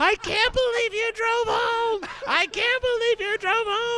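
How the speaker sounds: very high, loud squealed disbelief; high-pitched, slow, hammed-up hysterical wail; hysterical mocking friend. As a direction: imitate a friend in hysterics, drawn out. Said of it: "he's laughing hysterically".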